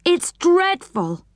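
slow, high plaintive wail; drawn-out, high moan of dismay; groaning. A narrator's reading. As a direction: stretch it out miserably, pitched high. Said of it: "he groaned".

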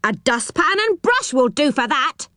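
high-pitched, dismissive call; crying out. A narrator's reading. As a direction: call it out through the door. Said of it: "cried the voice".

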